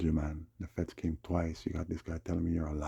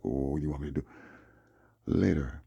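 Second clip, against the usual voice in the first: mocking Dave's attitude; 2 semitones lower than usual. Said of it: "this is his vibe".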